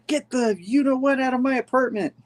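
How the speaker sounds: high-pitched mock outrage; acted indignant order. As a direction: order an intruder out in a high character voice. Acts out MJ ordering intruder out.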